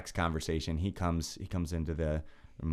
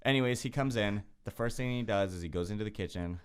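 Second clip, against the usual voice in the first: ordinary delivery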